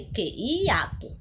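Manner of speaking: ordinary talk, nothing marked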